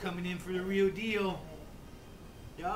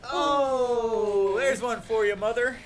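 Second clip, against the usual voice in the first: loud, higher mock triumph; teasing cheer; 5 semitones higher, 8 dB louder, slower than usual. Real, not acted. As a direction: crow at mom playfully. Teasing mother about baby.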